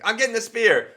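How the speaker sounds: high, loud mock battle cry; imitated comic yell. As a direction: imitate the comedian's caveman-style yell. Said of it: "I wonder if there's a joke like".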